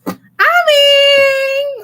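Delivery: high, loud flattered squeal; excited squeal. Real, not acted. Flattered by a viewer's compliment, squealing high. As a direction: squeal with delight at the praise.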